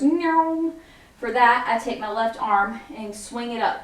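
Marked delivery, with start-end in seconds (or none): 0.0-0.7 a meow, higher; playful meow. Shown as a demonstration. Teacher imitating the song's meow, higher. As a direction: purr out a quick meow. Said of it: "it goes".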